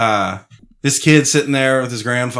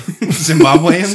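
slow, higher, drawn-out doubt; incredulous; 5 semitones higher, 5 dB louder, much slower than usual. Real, not acted. Host doubting a friend's made-up coffee story, incredulous. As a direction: stretch it out, not buying it.

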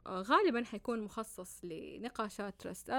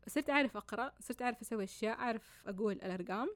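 usual speaking voice

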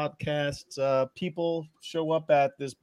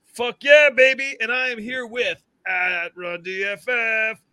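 shouted, higher hype-man opener; hyped show intro; 8 semitones higher, 6 dB louder than usual. Acted. Host opening the show for his co-host, loud and hyped. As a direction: pump up the crowd like a hype man.

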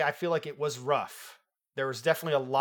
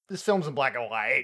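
weirdly delivered; 3 semitones lower than usual. Real, not acted. Host raising the film's look with co-host, weirdly. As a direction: oddly put statement lifting at the end. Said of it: "I said it really weird".

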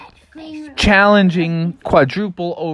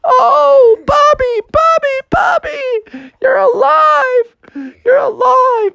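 hammed-up squealing happy sobs; crying mom; 4 dB louder, slower than usual. Mom weeps over her living son, high squealed crying. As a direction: wail it very high and tearful. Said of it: "his mom was crying tears".

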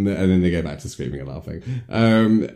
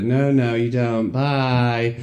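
brush-off; 6 semitones higher, slower than usual. Retold or imitated. Girl brushes off lovesick boy.

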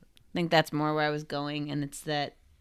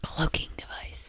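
soft whispered aside; 8 dB quieter than usual. Real, not acted. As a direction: breathe it out quietly.

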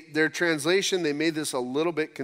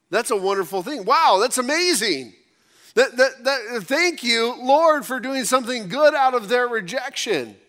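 higher and louder, marveling at grace; fervent praise; 6 semitones higher, 3 dB louder than usual. Real, not acted. Pastor praising God before the congregation, fervent and lifted. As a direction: lift the voice in wonder and thanks.

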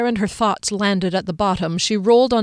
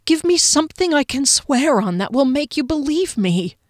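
desperate plea; 7 semitones higher, 4 dB louder than usual. A narrator's reading. Desperate pleading.

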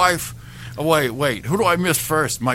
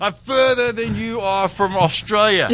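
put-on Australian accent. Caller from heaven jokes about distance, in a mock Australian accent. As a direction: lean into the Australian vowels. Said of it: "How do you say it in Australian?".

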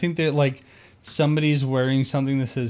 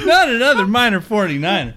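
laughing mock lament; 7 semitones higher, 6 dB louder, faster than usual.

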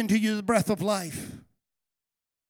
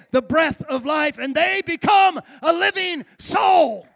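preacher roaring at his church; preaching at full force; 3 semitones higher, 4 dB louder than usual. Acted. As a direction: thunder it from the pulpit. Preaching on life.

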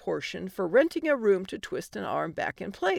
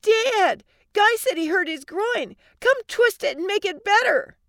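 put-on high, loud little-girl voice; child yelling; 12 semitones higher, 6 dB louder than usual. Little girl running home to dad, yelling. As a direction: call out eagerly like a kid. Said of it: "Josie ran home yelling".

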